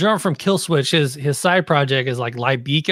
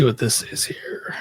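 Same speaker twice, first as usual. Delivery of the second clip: whisper; 6 semitones lower, 5 dB quieter, slower than usual. Real, not acted. Hunting for a file to share.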